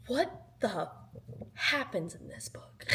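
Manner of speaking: secretive unvoiced whisper. Whispering a teaser to viewers about the book. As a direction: whisper it like a spoiler.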